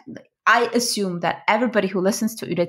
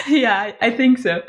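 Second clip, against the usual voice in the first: laughing; 5 semitones higher, 5 dB louder than usual. Real, not acted. Laughing while agreeing.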